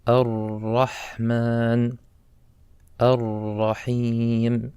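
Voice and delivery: Ar-Rahman and Ar-Rahim held long and slow; drawn-out recitation. Shown as a demonstration. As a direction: stretch each word as in recitation. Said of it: "notice each one of these words".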